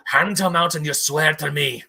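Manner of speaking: put-on loud, forceful dwarven accent; imitated Durin, accent laid on firmly; dwarf's put-on accent. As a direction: demand an oath in a dwarf's accent. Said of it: "Sorry, I'm gonna do an accent".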